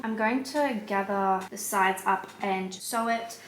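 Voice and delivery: ordinary delivery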